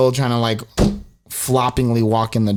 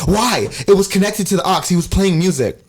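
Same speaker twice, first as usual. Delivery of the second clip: higher-pitched irritated protest; annoyed, raised-voice retort; pissed off; 5 semitones higher than usual. Retold or imitated. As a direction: imitate an exasperated friend complaining. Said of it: "Alejandro's like already pissed off".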